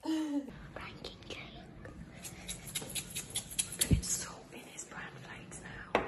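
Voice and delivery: hushed plotting whisper; conspiratorial whisper. Real, not acted. Kid plotting to salt dad's bran flakes, whispering. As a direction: whisper the next prank plan.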